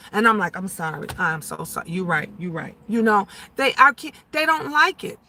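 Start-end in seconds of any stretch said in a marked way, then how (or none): 0.6-2.8 low, soft retold apology; meek apology. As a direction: drop your voice and concede, contrite. Retold apology to her son.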